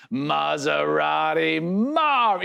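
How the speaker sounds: drawn-out, slow broadcaster call of the nickname; Gus Johnson's call. Retold or imitated. Analyst imitating Gus's booth call, drawn out. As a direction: stretch the name out like a big play-by-play call. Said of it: "his tone and his inflection".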